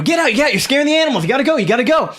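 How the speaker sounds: high, rapid urgent shooing; other guard's voice. Acted. Guard ordering screaming companion out, high and urgent. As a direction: hurry him out in a high voice. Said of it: "you hear the other guy go".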